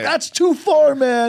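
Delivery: high, loud protest; playfully outraged yell; mock-offended shout. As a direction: holler it like a heckled guy storming off in jest. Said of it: "pretended to leave jokingly".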